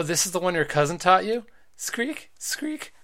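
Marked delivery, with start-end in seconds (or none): 1.8-2.9 spring squeak noise. Acted.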